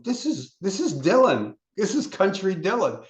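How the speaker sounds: high, thrilled exclaiming; excited. Retold or imitated. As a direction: gush about a great discovery. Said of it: "I'd get excited".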